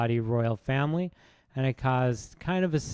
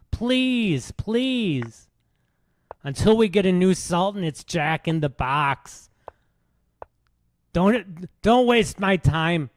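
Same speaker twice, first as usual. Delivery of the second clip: raised-pitch, hammed-up stand-up comic; Bill Burr impression; 5 semitones higher, 4 dB louder than usual. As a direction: play a pushy comic pleading and complaining to the crowd. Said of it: "What would Bill Burr say in Saudi Arabia?".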